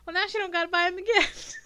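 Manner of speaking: high-pitched, through laughter; laughing retort. Real, not acted. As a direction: tease back while cracking up. Laughing while teasing back.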